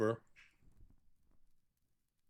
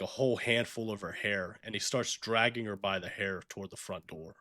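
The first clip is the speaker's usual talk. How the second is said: ordinary talk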